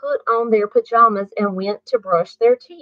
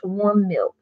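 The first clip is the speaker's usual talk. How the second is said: plain reading voice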